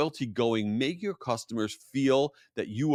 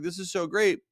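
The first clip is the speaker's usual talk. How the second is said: put-on higher, gleeful chorus of children; excited kids; 7 semitones higher than usual. Party kids cheering for Dora, higher and gleeful. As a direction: echo it like a happy crowd of kids. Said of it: "all the kids are like".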